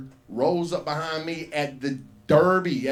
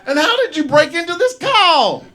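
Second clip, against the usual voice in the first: much higher, louder, laughing through it; freaked-out laughing yell; 11 dB louder than usual. Retold or imitated. Comic yelling at the boy who broke into the call, laughing. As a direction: yell it, spooked and cracking up. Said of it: "the little boy's like".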